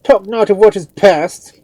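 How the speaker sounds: high, very loud cut-off; frantic priest demanding haste; impatient cry. A narrator's reading. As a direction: shout it impatiently. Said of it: "cried the priest impatiently".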